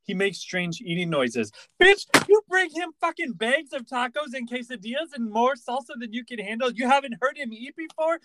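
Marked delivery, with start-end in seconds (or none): 1.8-8.2 higher, indignant rant at a TV character; exasperated rant. Real, not acted. Cohost ranting at a character about his eating, exasperated. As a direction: scold her with disbelief.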